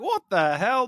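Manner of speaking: mock disbelief. Reacting in raised voice.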